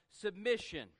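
Submission. punched high and loud; emphatic reveal. Real, not acted. Pastor reveals the S word to congregation, loud and emphatic. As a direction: land the word Submission. hard. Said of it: "the S word".

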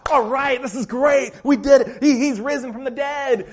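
high, loud cheering; hammed-up celebration; mock excited disciples. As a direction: cheer like they finally won. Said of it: "response of the disciples".

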